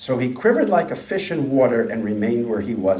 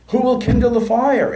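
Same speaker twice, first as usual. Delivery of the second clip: put-on helpless old woman; 11 semitones higher, 7 dB louder than usual. A narrator's reading. Sick widow cries out helplessly.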